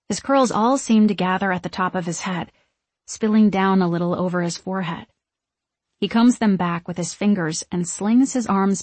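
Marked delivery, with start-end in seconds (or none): none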